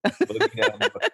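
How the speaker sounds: high laughing singsong echo. Real, not acted. Guest echoing the host's Brooklyn cheer, laughing. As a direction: toss the phrase back through a laugh.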